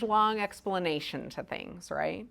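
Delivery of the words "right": ordinary delivery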